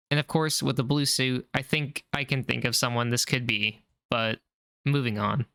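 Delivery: ordinary delivery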